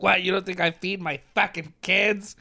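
mock affronted mom; offended mom. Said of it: "got, like, offended".

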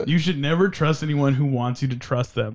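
usual speaking voice

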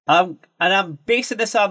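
ordinary delivery

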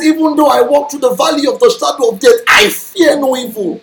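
bold declaration. Shown as a demonstration. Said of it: "That's how to talk".

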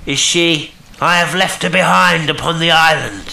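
high, loud call across the water. A narrator's reading. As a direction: call up to a ship from out in the dark. Unseen stranger calls to the schooner.

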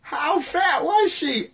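high, slow crowd shout; a high, drawn-out heckle from the crowd; audience calling out. Retold or imitated. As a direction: call it out like the whole audience. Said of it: "the audience goes".

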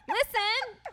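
loud, high retold "told you so"; hammed-up exasperated reply, pitched high and loud; mock exasperation. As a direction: play up being fed up at finally being heard. Continues exasperated retold reply to husband.

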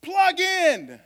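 high, bright plea; Holy Spirit voice. Pretend. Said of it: "The Holy Spirit be like".

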